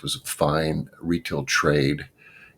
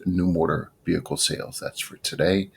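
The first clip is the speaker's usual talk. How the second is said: usual speaking voice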